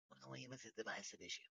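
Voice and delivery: very soft, quick mumbled imitation; evasive excuse. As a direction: shrink back while imitating a timid excuse.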